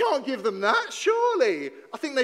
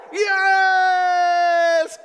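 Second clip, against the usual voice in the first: celebration shout; 6 semitones higher, 10 dB louder than usual. Acted.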